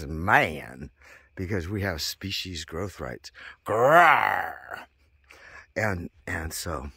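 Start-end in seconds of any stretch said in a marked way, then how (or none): 3.7-4.8 a loud mock animal growl; loud hammed-up growl; playful growl. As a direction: growl like a cat. Growl after man remark.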